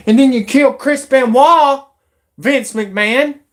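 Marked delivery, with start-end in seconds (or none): none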